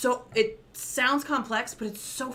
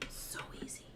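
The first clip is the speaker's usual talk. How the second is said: whisper; 18 dB quieter than usual. Real, not acted. Aside while cooking.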